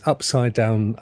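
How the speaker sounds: normal talking voice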